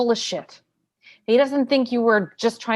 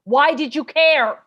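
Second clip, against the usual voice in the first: angry demand; 5 semitones higher, 5 dB louder, slower than usual. Acted. Lawyer cutting off witness.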